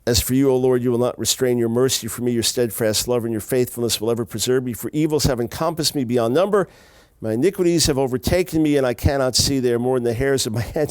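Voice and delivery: loud, declaiming psalm reading; scripture reading. Acted. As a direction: proclaim the psalm to the congregation. Reads the psalm aloud.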